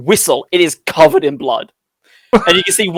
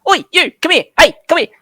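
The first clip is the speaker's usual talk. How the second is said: high, loud little pixie hail; pixie yelling; 13 semitones higher, 4 dB louder, much faster than usual. Acted. Pixie hails the passing adventurers for help, yelling high. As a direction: call out urgently as a tiny fairy. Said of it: "one of them yells".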